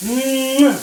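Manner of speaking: loud smacking kiss on a hurt finger; kiss sound. Real, not acted. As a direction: plant a big playful mwah. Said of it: "let me kiss it for you".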